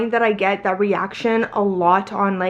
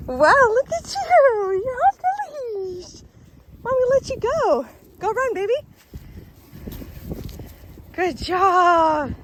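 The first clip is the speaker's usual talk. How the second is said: very high, slow cooing; 12 semitones higher, slower than usual. Real, not acted. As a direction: squeal praise at a puppy. Cooing praise to her dog.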